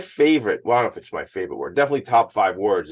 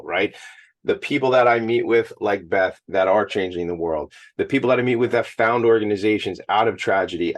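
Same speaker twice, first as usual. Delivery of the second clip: usual speaking voice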